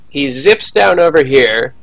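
normal talking voice

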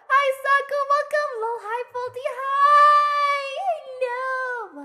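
high squealed hellos; excited greeting. Real, not acted. Streamer greeting arriving viewers, excited welcome.

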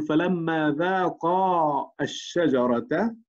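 "فلما ذاقا الشجرة" read very slowly in a recitation style; "فلما ذاقا الشجرة" drawn out carefully. Shown as a demonstration. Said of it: "نقول".

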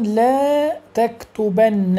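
لا تكتبن very slow, nun held long; emphatic nun drawn out. Shown as a demonstration. Teacher gives an example to the class, very slowly. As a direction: stretch out the نّ of تكتبن. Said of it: "أقول مثلًا".

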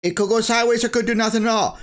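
hammed-up mockery of a cautious YouTuber, last line rushed; mocking wimpy YouTuber. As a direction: sneer at a timid pundit by hedging in a high voice. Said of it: "wimpy cuck YouTubers going".

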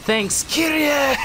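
high, hammed-up yelling over trivia; [name], intensely dramatic. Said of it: "He's just always dramatic".

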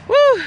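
short, high "woo"; whoop. Real, not acted. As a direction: let out a high whoop mid-walk. Whoops while power walking.

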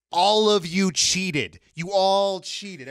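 slow, loud mock accusation. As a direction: drag out the accusation, teasing.

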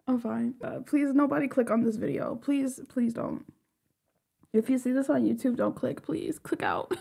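soft, subdued, sheepish plea; quiet pleading. Real, not acted. Embarrassed fan begging viewers not to click, quiet pleading. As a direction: beg under your breath.

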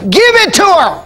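high, ringing command from the judge; judge's verdict. Retold or imitated. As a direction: proclaim it like a judge giving in. Judge voiced in retelling.

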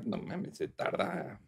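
soft hushed wife voice; mock whisper. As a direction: hiss it low like his wife. (esposa susurrando apurada)

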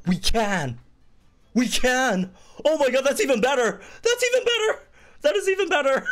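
very high, loud squeals of delight, breaking into a laugh; giddy and losing it over good news; freaking out. Real, not acted. Streamer tells viewers the update is even better, squealing.

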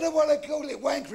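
high-pitched insistence; stubborn child. Acted. Boy insisting on donkey's name, high and stubborn. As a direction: dig in like a pestering kid.

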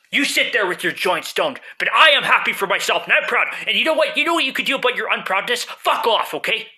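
loud, high angry rant; arguing with myself. Acted. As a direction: tell him off, loudly. Quarrel with stoned self.

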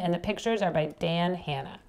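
plain reading voice

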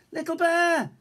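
Big Bear calling as the cub slips; alarmed call. A narrator's reading. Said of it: "called Big Bear".

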